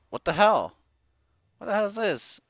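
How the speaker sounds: genuinely baffled outburst; startled outrage. Real, not acted. Game glitch frustration.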